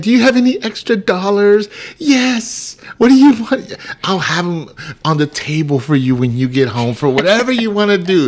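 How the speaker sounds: high and loud, over-eager; mock eager husband. Husband mimics himself promising wife money. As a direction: gush it all out, desperate to please.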